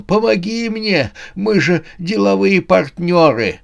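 high, pleading call to a partner; crying out. A narrator's reading. Old man appeals to Death as business partner, crying out. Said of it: "кричал он".

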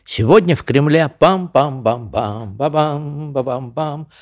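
solemn newsreel voice humming the fanfare; old newsreel narrator. Retold or imitated. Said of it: "в киножурнале «Новости дня»".

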